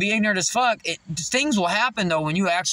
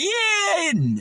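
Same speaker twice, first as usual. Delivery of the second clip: laughing mock reply; 13 semitones higher than usual. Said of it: "You're like".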